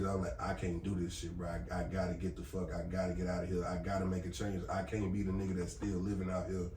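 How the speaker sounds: usual speaking voice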